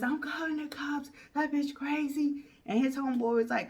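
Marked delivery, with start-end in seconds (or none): 0.0-2.4 higher petulant whine; a higher put-on voice for the angry boyfriend; upset boyfriend. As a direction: whine it like a sore loser.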